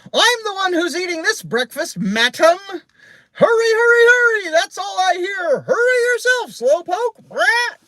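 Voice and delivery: squawking parrot, very high and loud; piercing, bossy bird screech; Penelope. A narrator's reading. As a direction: squawk indignantly like an offended parrot. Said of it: "Penelope squawked".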